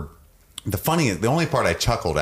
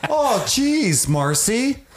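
high-pitched, comically dismayed doctor; Brenner; much slower than usual. Retold or imitated. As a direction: do a hammed-up "oh jeez" reaction. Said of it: "I picture him coming in".

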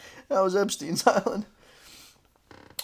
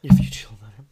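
whispered echo; 4 dB louder, much faster than usual. Pretend. Echoing the bot's line.

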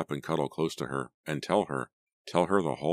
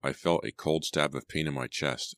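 plain reading voice

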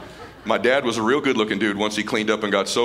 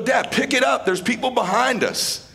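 raised, much higher, half-teasing call; 13 semitones higher than usual. Retold or imitated. Son hurrying dad on course.

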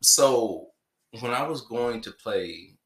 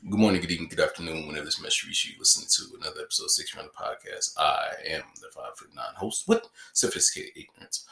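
podcast intro; 3 semitones lower, faster than usual. Acted. Host's podcast opening.

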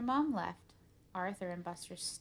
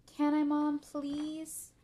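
high, loud wheedle with a drawn-out please; pleading child; 7 semitones higher, 8 dB louder, much slower than usual. Acted. Arthur asking Mom to play the game, pleading. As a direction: beg like a kid wanting a treat.

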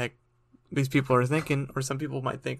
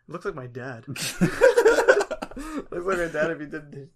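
laughing through the words; slow, loud giggle while talking; 2 semitones higher, 7 dB louder, slower than usual. Real, not acted. Laughing at a photo.

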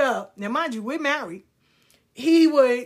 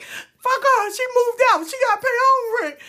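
much higher, louder outraged yell; indignant shout; 7 dB louder than usual. Retold or imitated. Teen daughter raging at her sister's rent getting paid, shouting. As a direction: blurt it out jealous and furious. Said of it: "I was like".

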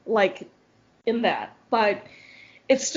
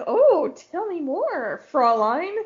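smitten German guy; 5 semitones higher than usual. Retold or imitated. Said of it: "all the German boys are like".

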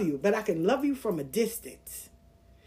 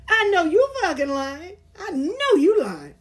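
incredulous; 6 semitones higher than usual. Acted. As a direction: call out a friend in mock outrage. Acted disbelief at pushy friend.

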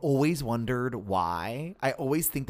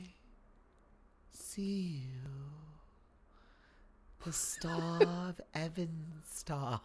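slow, soft, airy elf princess; Liv Tyler as Arwen; 3 semitones higher, 9 dB quieter, slower than usual. Retold or imitated. Imitating Arwen's lines to a friend, soft and slow. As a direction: drift through it dreamily and slowly. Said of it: "All she does is go".

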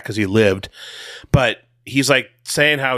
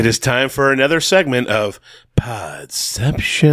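mock announcer. Podcaster introducing a made-up segment, mock announcer. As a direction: announce it like a show jingle, stretching the last word.

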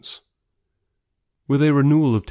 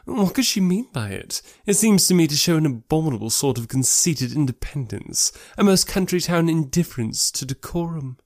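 higher-pitched, scandalised character voice; disapproving society lady; 7 semitones higher than usual. Acted. Lady condemning a walk in the dirt, disapproving. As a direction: voice a lady looking down on such behaviour, pitched up.

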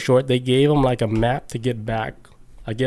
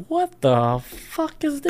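much higher, slow shocked outburst; startled cry; 14 semitones higher, slower than usual. Retold or imitated. Sailors seeing the spacecraft, shocked and high. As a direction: blurt it out in disbelief.

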